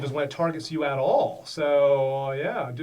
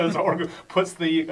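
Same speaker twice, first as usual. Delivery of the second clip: ordinary talk, nothing marked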